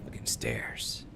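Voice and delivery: tense low whispered curse. Real, not acted. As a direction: hiss it under your breath. Creeping up basement stairs.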